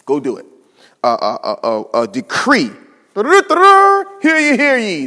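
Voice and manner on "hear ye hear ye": high, loud town-crier call; herald's cry. Pretend. Preacher mocking a herald's proclamation.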